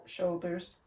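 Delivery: ordinary delivery, nothing marked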